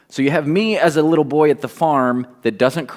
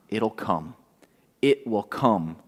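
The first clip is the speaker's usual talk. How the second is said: quiet; 4 semitones lower, 8 dB quieter than usual. Acted. Preacher repeats promise softly.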